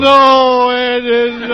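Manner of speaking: loud, overdone pretend sobbing; hammed-up fake wail. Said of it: "Would you stop crying".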